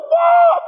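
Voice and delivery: high loud crying wail; preacher imitates his son crying after the taxi; mock toddler wail. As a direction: howl like an abandoned toddler. Child crying as taxi leaves.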